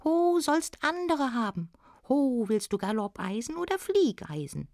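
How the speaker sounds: high-pitched dwarf, long opening "Ho"; little smith. Acted. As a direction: call it out in a high little goblin voice. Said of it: "sagte der Kleine".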